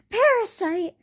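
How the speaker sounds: high, offended echo of the word; acted affronted squeal; indignant disbelief. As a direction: repeat it as a mock-outraged question. Venom indignant at being called parasite.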